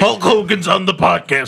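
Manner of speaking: lightly put-on wrestler growl; mock wrestler. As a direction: hype it like a wrestler. Wrestler impression, "brother.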